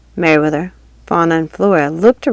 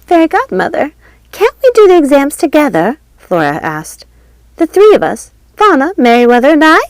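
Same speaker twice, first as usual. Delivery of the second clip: put-on much higher, louder pleading girl voice; high-pitched schoolgirl fairy asking; eager fairy girl; 10 semitones higher, 6 dB louder than usual. A narrator's reading. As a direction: beg the teacher sweetly. Flora pleading in story.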